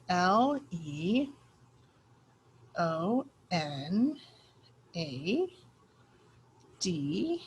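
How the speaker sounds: letter by letter, slow and clear; spelling out letters. Shown as a demonstration. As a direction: spell each letter slowly. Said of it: "We're gonna copy that word".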